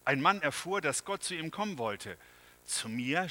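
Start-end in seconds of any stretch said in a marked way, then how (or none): none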